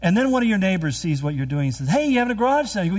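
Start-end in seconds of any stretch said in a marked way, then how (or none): 1.9-2.8 higher, mock-friendly neighbor voice; chirpy neighbor. Neighbor calling over about the garage sale, perky and high. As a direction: pitch it up like a cheery neighbor.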